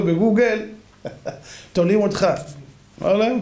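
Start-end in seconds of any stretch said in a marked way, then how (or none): none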